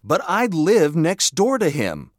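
high-pitched, alarmed protest; panicked shout. A narrator's reading. Boy living next door to the knifeman, panicked shout. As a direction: pitch it up as a mild panicked yell. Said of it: "I shouted".

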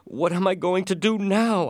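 high, loud cry of despair; desperate cry. A narrator's reading. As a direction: cry out in panic. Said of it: "I cried".

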